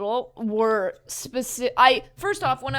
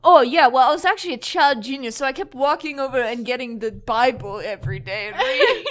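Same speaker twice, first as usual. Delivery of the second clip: sarcastic boast; 7 dB louder than usual. Acted. Sarcastic boast about being a genius.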